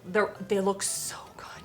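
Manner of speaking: hushed, secretive whisper. Real, not acted. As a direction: whisper it so no one hears. Sneaking a look at marshmallows.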